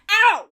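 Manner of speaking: sudden high yelp of fake pain; mock ow. Vlogger clowning after a pun, loud mock yelp. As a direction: yelp as if your own joke hurt you. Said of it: "I'm so funny it hurts".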